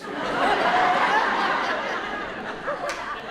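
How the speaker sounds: high rising burst of laughter. Real, not acted. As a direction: laugh at the punchline. Laughs after punchline.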